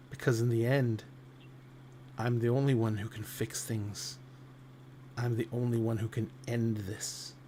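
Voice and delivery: grim Atropos. Acted. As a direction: state it quietly as the only one who can end it. Atropos claims sole power.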